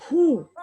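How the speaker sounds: loud, high exhale of relief; relieved whew. Retold or imitated. Body finally calming down, big relieved whew. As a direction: let out a big whew as the tension drops. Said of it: "your body is gonna go".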